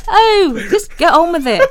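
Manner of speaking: high, loud impatient cry through laughter; exasperated laughing. Retold or imitated. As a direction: urge them on, laughing in frustration. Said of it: "part of me is a bit like".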